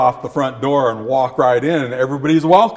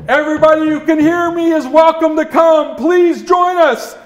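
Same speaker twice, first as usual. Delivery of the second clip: high, loud call to everyone; 11 semitones higher, 4 dB louder than usual. Acted. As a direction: call out an invitation across the distance. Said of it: "he completed calling out".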